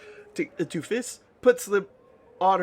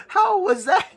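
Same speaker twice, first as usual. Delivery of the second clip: very high, loud squeal; eager question; 9 dB louder than usual. Acted. Nanny asks player how her insult went, high and eager. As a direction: ask excitedly for approval.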